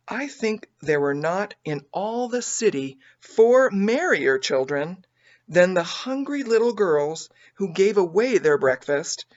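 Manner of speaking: usual speaking voice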